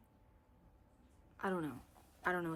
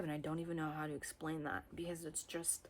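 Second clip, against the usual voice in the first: ordinary delivery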